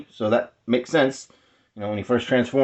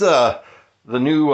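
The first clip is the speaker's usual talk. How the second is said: ordinary delivery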